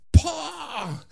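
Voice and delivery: smack the sound out sharply; imitated fist slam on the table; fist bang. A narrator's reading.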